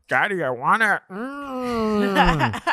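high pouty little-kid impression; imagined whiny child. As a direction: whine high like a child begging daddy. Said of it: "what our child will look like".

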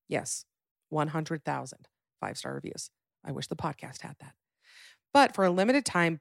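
upbeat sponsor read, steady and polished. Acted. Host pitching sheets to listeners, easy ad-read voice. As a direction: sell the sheets like a podcast host ad.

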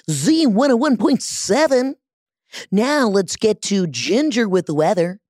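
booming-styled, swooping DJ station call; radio disc jockey. Pretend. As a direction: play a hammed-up radio host handing over to weather. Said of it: "I love doing a radio disc jockey voice".

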